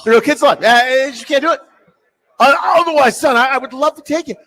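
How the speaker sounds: a fibbing father laying it on thick; mock dad. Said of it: "He's like".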